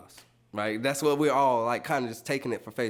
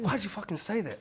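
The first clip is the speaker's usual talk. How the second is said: high, flustered inner voice; 9 semitones higher than usual. Retold or imitated. Man scolding in his head after the argument, high and flustered. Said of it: "flustered in my head".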